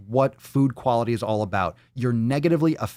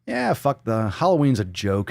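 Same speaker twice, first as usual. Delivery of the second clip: ordinary talk, nothing marked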